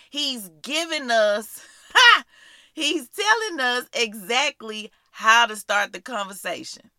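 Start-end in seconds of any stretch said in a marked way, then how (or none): none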